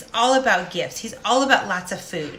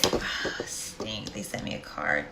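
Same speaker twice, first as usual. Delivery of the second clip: whispered grumble, softer; mock whisper; 13 semitones lower, 9 dB quieter, slower than usual. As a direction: breathe it out as a private gripe. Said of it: "The rest of us are like this".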